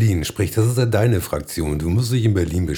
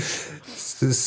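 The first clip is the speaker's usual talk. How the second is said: normal talking voice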